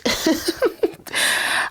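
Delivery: a high, breathless, mostly unvoiced laugh; breathy high laugh. Real, not acted. Reader cracking up at her own joke, breathy and high.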